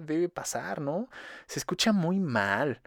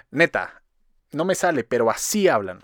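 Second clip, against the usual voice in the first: ordinary talk, nothing marked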